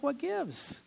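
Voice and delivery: high, baffled exclamation; baffled what gives. Retold or imitated. Husband baffled his wife never opened the gift, high and disbelieving. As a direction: pitch it up in disbelief. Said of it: "You're like".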